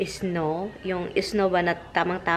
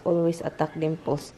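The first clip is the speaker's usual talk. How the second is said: normal talking voice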